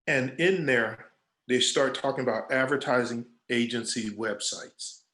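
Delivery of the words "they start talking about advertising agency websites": normal talking voice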